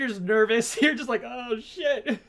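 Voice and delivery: high-pitched talk through real laughter; amused, laughing. Real, not acted. Co-host teasing about the nervous crowd, laughing. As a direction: crack up while teasing.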